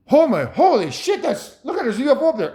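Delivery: hammed-up astonishment; mock awe. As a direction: play someone amazed by a ufo overhead. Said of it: "it's a term of".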